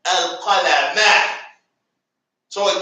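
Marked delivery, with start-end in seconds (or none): 0.0-1.4 al-qalama said slowly, ending clearly in -a; final -a stressed. Shown as a demonstration. Teacher slowly models al-qalama for students, ending on a clear a. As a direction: stretch out al-qalama and land on the final a. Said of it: "Not al-qalamu".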